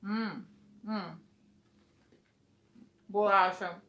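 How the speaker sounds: chewing while talking; speaking between bites; mouth full of cookie. Real, not acted. Seen 0: Woman bites cookie just before, chews with closed mouth while holding it.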